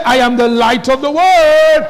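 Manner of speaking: loud declaration. Shown as a demonstration. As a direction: shout it out to the church like a rallying cry. Repeats the line, much louder.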